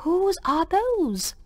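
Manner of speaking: soft, slow hushed question; a girl whispering a secret question. A narrator's reading. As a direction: ask slowly under your breath. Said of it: "she whispered to him".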